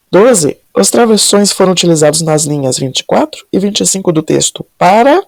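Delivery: ordinary delivery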